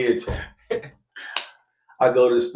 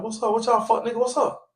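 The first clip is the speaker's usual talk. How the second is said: confrontational; 12 semitones higher than usual. Retold or imitated. Challenging the cousins.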